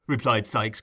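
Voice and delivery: plain reading voice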